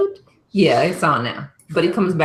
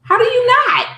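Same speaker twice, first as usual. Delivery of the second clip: incredulous retort; 4 dB louder than usual. Retold or imitated. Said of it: "I'm like".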